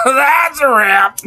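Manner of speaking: slow, laughing through the words. Real, not acted.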